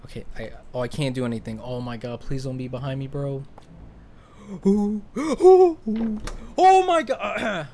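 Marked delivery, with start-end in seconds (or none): 4.5-7.7 high, loud frightened gasps; real fright at a jump scare; startled gasps. Real, not acted. Player jolted by a scare, gasping high and loud.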